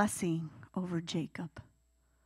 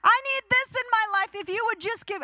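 put-on very high, louder childish whine; whiny pleading child; 12 semitones higher, 5 dB louder than usual. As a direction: plead like a kid demanding something. Whining prayer like a child.

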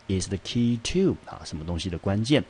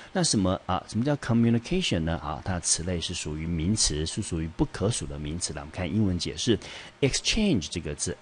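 normal talking voice

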